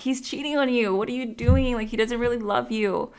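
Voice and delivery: quoting herself telling off her mom, pitched high; retold confrontation. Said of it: "I went off on my mom".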